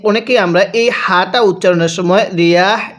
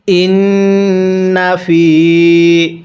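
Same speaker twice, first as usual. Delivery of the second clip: tajweed recitation; much slower than usual. Shown as a demonstration. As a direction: lead the recitation for them to repeat. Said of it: "তাহলে কিভাবে পড়ব?". (সাথে পড়ার জন্য উচ্চস্বরে তিলাওয়াত)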